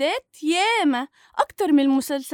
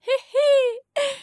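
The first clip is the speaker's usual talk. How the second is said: teasing giggle; 11 semitones higher than usual. Acted. Gigi laughs at her.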